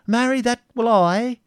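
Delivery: very high, loud clownish exclamation; Wamba. A narrator's reading. Clown answering the prince's defiance, high and loud. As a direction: pipe up in bold answer. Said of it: "exclaiming in answer to the prince's defiance".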